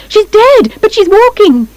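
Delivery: high-pitched, panicky cry; high, horrified outcry; alarmed shout. A narrator's reading. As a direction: shout it in disbelief. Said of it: "Missus Carp shouts".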